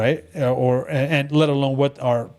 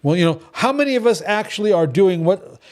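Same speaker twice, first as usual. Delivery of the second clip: ordinary delivery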